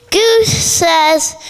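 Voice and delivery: plain reading voice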